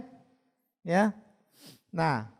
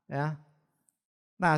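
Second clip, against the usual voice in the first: usual speaking voice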